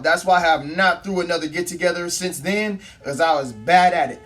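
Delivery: normal talking voice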